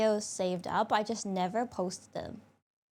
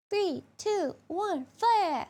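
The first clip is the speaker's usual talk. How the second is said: high loud playful launch call; excited countdown shout; 10 semitones higher, 5 dB louder, much slower than usual. Pretend.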